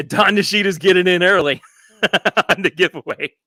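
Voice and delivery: amused laugh while reading the name. Real, not acted. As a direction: chuckle your way through it.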